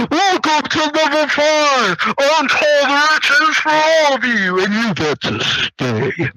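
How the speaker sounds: very slow, drawn-out villain announcement; Sylvester Stallone. Retold or imitated. Villain greeting players at level five, slow booming voice. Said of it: "you hear Sylvester Stallone".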